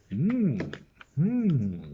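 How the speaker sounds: high, loud drawn-out frog hum; the frog mulls it over in a put-on voice; pondering frog. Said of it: "said the frog".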